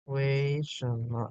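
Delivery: 为什么 drawn out very slowly to show tones; teacher models 为什么 slowly; slow model phrase. Shown as a demonstration. Teacher explains the tones of 为什么, very slowly. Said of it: "Ini 为 ada dua".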